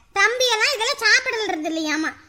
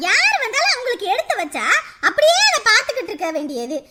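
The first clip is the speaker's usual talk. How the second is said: usual speaking voice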